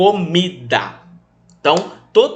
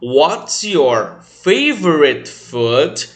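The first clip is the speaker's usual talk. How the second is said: very slow, drawn-out question; slow teacher question; much slower than usual. Shown as a demonstration. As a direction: model the teacher's question slowly. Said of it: "quando o tio perguntar". (pergunta do tio, bem devagar)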